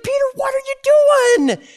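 high-pitched confrontation with Peter; Paul confronting Peter. Retold or imitated. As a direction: get right in his face and demand an answer. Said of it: "He's like".

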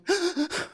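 high little gasp; a small startled intake of breath; child's gasp. Retold or imitated. Child gasping after spanking.

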